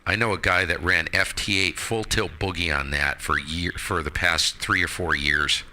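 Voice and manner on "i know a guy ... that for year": ordinary talk, nothing marked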